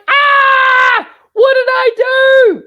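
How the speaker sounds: high, loud dismayed cry of horror; mock horrified squeal; shocked shriek. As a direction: shriek it like you just saw the damage. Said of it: "And I'm like".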